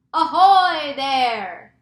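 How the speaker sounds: long, booming hail at high volume; drawn-out, full-voiced shout. A narrator's reading. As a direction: holler up to someone far above. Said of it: "shouted the chief of police".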